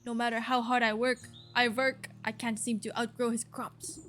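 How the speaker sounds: put-on grumbling farmer in a rough French accent; hammy French-accented farmer. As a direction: complain with an attempted French accent. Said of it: "is this a French accent?".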